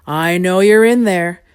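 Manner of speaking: loud, slow, rumbling creature voice; booming, unhurried monster voice; deep rumble. A narrator's reading. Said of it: "rumbled a very deep voice".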